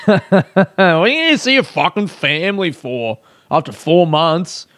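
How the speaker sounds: incredulous question through laughter; laughing incredulity. Real, not acted. Comedian mocking Easter family visits, laughing incredulously.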